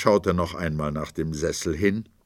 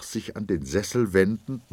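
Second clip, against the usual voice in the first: ordinary delivery, nothing marked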